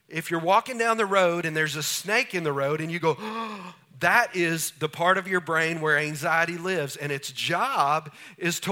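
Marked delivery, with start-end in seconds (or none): 3.2-3.7 sharp, sudden intake of breath; startled gasp. Acted. Preacher acts out meeting a snake in the road, startled gasp.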